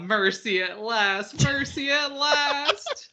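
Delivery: dying little creature, high and drawn out; Luma. Retold or imitated. Little star creature welcoming its slow death, blissfully relieved. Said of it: "the little creature is just like".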